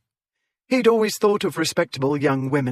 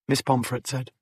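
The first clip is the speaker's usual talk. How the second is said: ordinary delivery, nothing marked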